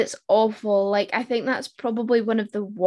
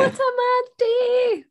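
drawn-out, high-pitched quote; slow, high playful mimicry. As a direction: pitch it way up and stretch it out. Breaks into a mimicked film line.

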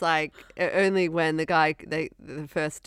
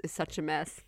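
ordinary talk

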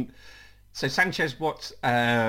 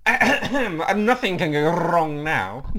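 loud, raised, drawn-out take on oneself; hammed-up version of your own voice, slow and higher; own voice, exaggerated; 4 semitones higher, 6 dB louder, much slower than usual. Shown as a demonstration. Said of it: "do it in your voice so we can".

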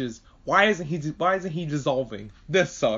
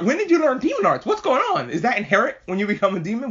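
rapid, much higher rush of questions; frantic questions; 7 semitones higher than usual. Retold or imitated. Reacting to the sister's new power, frantic and squeaky. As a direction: pepper her with questions, squeaking. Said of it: "And I'm like".

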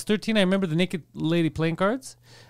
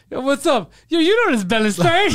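high, loud cocky greeting; mock swagger; 7 semitones higher, 5 dB louder than usual. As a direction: strut in playing the catchphrase guy. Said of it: "I keep thinking it's like".

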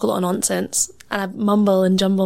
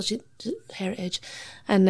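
ordinary talk

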